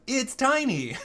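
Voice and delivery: high-pitched mock announcement; game pop-up exclamation. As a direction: squeal it like an excited game pop-up. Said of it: "the little pop-up that comes over, it says".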